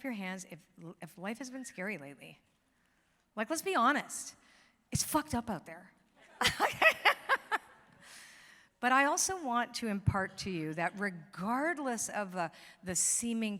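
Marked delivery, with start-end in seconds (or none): 6.4-7.6 real high-pitched, loud burst of laughter; high cackle. Real, not acted. Laughs at own blunt remark.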